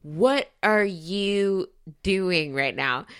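very slow, word-by-word disbelief; slow, deliberate mock telling-off; incredulous scolding. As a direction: spell out your dismay, drawing out every word. Scolding the contestant while watching.

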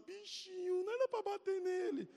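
exaggerated tearful little-boy pity; Halley's pitying whine. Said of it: "Halley viu o menino chorando".

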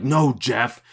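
mock protest. Quoting a film villain's line.

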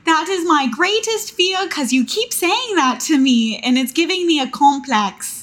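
put-on high-pitched little British child; British baby voice. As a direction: complain like a small British boy. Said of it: "That's my British baby voice".

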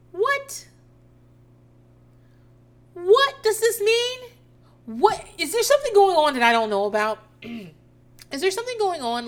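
high, loud stunned questions; can't believe what she just heard; baffled disbelief. Real, not acted. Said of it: "what is she trying to say?".

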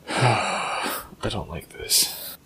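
slow, breathy scared whisper. Real, not acted. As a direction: hush it out, uneasy. Scared whisper in dark pyramid.

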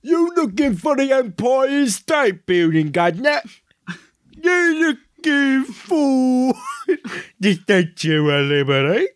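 put-on light New York street accent; New York tourist hustler. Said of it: "New York sounds".

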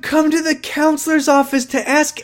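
very high-pitched incredulous outburst; exasperated rant. Real, not acted. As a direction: demand an explanation, pitch way up. Exasperated rant about the plot.

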